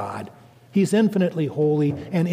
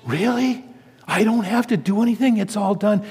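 higher-pitched questioning, imitating surprised schoolgirls; incredulous girls; 5 semitones higher than usual. Schoolgirls hearing about grace for the first time, incredulous. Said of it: "they all said".

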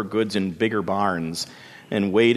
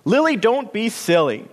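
bossy sister; 10 semitones higher, 3 dB louder than usual. A narrator's reading. Bean Plant chiding.

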